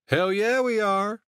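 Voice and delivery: high, loud whooping cheer; too-loud cheer. A narrator's reading. Tipsy brother answering his cousin, cheering too loudly. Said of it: "Sergio cheered, a little too loudly".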